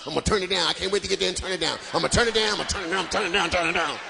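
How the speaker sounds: gleeful low grumble, rushing the lines; low mock committee member. Comedian mocks a church committee member, gleeful low voice. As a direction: play the eager naysayer, low and fast.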